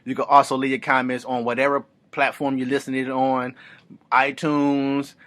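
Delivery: usual speaking voice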